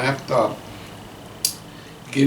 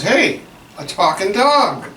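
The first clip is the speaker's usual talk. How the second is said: higher, louder amazed punchline; amazed horse; 6 semitones higher, 3 dB louder than usual. Acted. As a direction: gasp it out, amazed at a talking dog.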